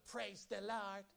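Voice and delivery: a curt, unfriendly praise the Lord; grudging praise. Retold or imitated. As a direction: say it like you don't mean it. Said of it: "You don't even say that nice".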